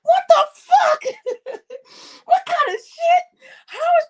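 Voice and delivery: high, loud laughing disbelief; half yell, half laugh in outrage; shocked outburst. Real, not acted. Outraged disbelief retelling insult.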